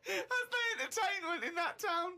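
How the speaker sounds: high voice breaking up with laughter; laughing. Real, not acted. Co-host on the cat as the town's entertainment, laughing. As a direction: crack up while talking.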